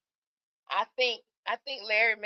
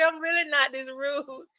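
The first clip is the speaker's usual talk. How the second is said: ordinary talk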